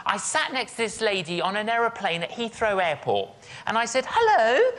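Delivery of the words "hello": high, loud retold hello with a lift; bright acted hello. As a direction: greet a seatmate in an acted, high rising voice. Acted greeting to fellow passenger.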